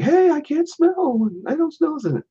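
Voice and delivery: very high, slow squeaky voice; high silly voice of a mascot with a blocked nose; mock stuffy Jack. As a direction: squeak it out like a pinched-nose cartoon. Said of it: "Jack's like".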